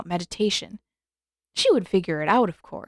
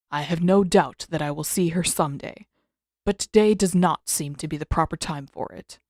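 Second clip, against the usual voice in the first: put-on lower, measured voice of an old master; a grumpy elder rebuking his student, lower; stern old Avatar Roku; 4 semitones lower than usual. Roku chides Aang for dawdling, in a lower stern voice. Roku's voice in story.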